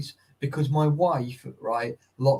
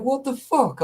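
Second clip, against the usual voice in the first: shocked; 10 semitones higher than usual. Retold or imitated. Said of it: "I was like".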